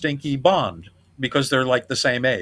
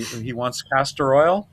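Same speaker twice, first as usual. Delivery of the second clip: usual speaking voice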